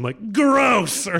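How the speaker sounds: loud, high exaggerated revulsion; hammed-up cry of disgust; mock disgust. Imagined disgusted reaction, acted out.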